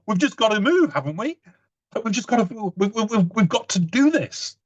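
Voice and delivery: high, stammering flustered dad; mock panic. Dad rushing the family through the park, mock panic. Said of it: "a look of panic in his eyes".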